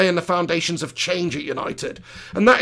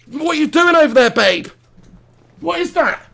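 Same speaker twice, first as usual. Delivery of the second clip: irritated; 7 semitones higher than usual. Real, not acted. Partner rustling during recording.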